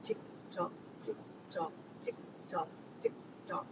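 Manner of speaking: very soft, unvoiced tick-tock; hushed, evenly spaced countdown; whispered clock ticking. Mimics a clock while waiting.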